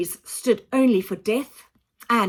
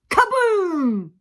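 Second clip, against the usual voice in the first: big booming kaboom, higher and louder; 9 semitones higher, 6 dB louder than usual. A narrator's reading. Narrator to children, acting the blast with a loud kaboom. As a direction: shout the explosion.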